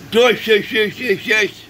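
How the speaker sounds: very high, loud mock gibberish; mocking idiot voice. Imitating a man mangling names to mock the president, high idiot squeal. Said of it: "he sounded more like an idiot".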